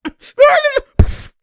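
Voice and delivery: high wailing laugh; high squealing laugh. Real, not acted. Laughing hard at a play, high squeal.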